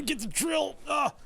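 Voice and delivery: slow, then a sudden high yelp; startled yelp. Real, not acted. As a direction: caught off guard mid-sentence. Drill bites and slips.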